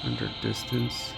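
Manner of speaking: normal talking voice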